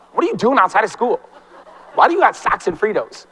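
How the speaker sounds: imitating baffled students, pitch swooping; mock freak-out. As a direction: play a student mildly freaking out. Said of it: "they freak out".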